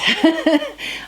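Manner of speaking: short high burst of laughter. Real, not acted. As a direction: let out a high giggle. Laughs after lighter subject remark.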